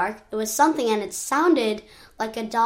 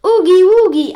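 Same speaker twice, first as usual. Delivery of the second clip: high, loud cry of the whole crowd; full-voiced high yell; 9 semitones higher, 13 dB louder than usual. A narrator's reading. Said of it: "They all yelled".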